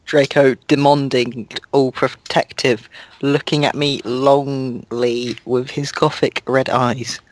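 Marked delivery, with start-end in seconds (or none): none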